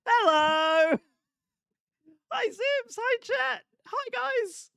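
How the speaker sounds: very high cutesy squeak; playful gamer girl headset. Pretend. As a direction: chirp greetings in a tiny voice. High squeaky greetings to chat.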